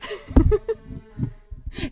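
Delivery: high loud cackling laugh; loud burst of laughter. Real, not acted. Laughing after quitting whisper.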